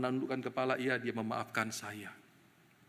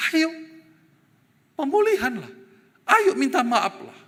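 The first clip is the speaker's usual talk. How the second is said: loud, high urging voice; urgent conscience; 9 semitones higher, 9 dB louder than usual. Retold or imitated. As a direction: push him hard to take the lead. Conscience urges him to act.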